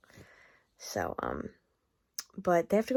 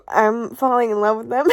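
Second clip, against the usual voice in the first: laughing; 4 semitones higher, 9 dB louder than usual. Real, not acted. Laughs at her own emotion.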